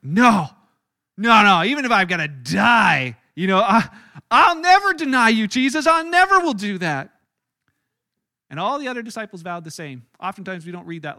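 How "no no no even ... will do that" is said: loud, insistent denial, rising high; loud protest. Acted. Peter loudly insisting to Jesus he'll never deny him. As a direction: shout it down, refusing to believe.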